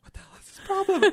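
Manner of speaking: high, indignant friend voice; high mock outrage. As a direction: squeak out the indignation. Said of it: "Evan looks at me and goes".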